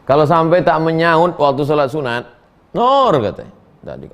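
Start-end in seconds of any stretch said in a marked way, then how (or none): 2.7-3.1 high, louder call of the name; mother calling out. Retold or imitated. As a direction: shout the daughter's name from another room.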